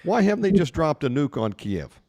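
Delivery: usual speaking voice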